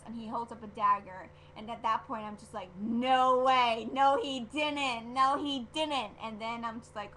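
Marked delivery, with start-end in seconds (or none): 2.8-6.1 loud, drawn-out disbelief; hammed-up loud shock. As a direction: replay your stunned denial, loud and slow. Replaying shocked reaction to dagger.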